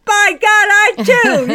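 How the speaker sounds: high, loud and slow, fired up; a sudden surge of defiant resolve; rallying cry. Retold or imitated. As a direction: declare it like a rousing vow. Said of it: "And I thought".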